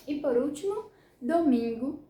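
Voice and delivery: ordinary delivery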